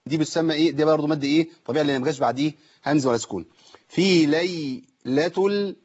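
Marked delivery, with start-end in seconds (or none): 3.9-5.8 slow chant stopping on the "ال" lam; held lam. Shown as a demonstration. Teacher showing still lam, "ال" held in slow chant. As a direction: recite "في ليلة ال" slowly and rest on the l. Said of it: "لام ساكنة لازم أقف عليها".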